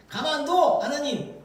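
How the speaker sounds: high, commanding mock rebuke; acted-out telling-off; mock scolding. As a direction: order God about indignantly. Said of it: "하나님을 혼내키는 것인지".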